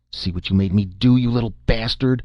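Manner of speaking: put-on a drunk father rounding on his bleeding son, raging; drunken growl. A narrator's reading. Drunk father blames his son for the spilled bottle, angry growl. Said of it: "he growled".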